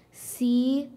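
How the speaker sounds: slow, letter by letter; spelling it out. Real, not acted. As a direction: spell each letter out slowly. Speller spells the word aloud.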